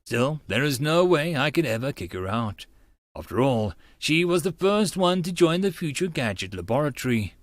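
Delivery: ordinary talk, nothing marked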